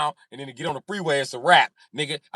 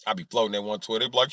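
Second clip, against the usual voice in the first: usual speaking voice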